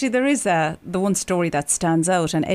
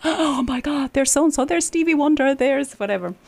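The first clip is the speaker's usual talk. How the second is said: high, breathless excitement at famous faces; imitating starstruck awe backstage; starstruck gasp; 9 semitones higher than usual. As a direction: gush like a starstruck kid. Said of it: "and we're just like".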